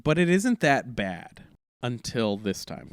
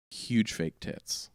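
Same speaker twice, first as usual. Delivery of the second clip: ordinary talk, nothing marked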